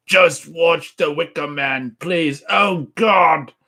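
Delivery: loud, high-pitched hammed-up British; British accent. Reading a viewer's all-caps chat message aloud, hammed-up British accent. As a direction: plead in a mock British accent. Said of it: "C-Snap doing a British accent".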